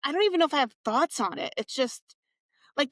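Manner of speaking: ordinary talk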